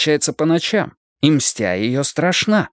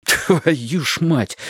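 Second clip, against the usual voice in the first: angry whisper; 5 semitones higher, much slower than usual. A narrator's reading. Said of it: "шеплю злобно".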